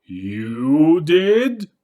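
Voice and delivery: high, loud, rising ghostly anger; angry ghost. Acted. The ghost angrily confronts the group, high and loud. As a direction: demand it, angry. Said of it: "it said angrily".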